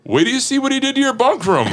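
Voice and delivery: high, quick imitation of a far-off tattler; voice in the distance. Storyteller imitates coworker telling on him from the kitchen, high distant call. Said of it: "you hear in the distance".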